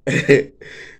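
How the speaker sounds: a sudden, loud, breathy laugh. Real, not acted. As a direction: crack up at yourself. Laugh after an aside.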